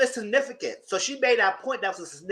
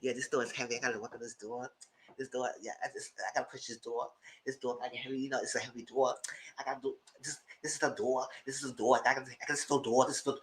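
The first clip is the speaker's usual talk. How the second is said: low, much softer mumbling mimic of the agent; mocking the agent; 7 semitones lower, 12 dB quieter, faster than usual. Speaker imitating the agent fussing with a heavy door, low and soft.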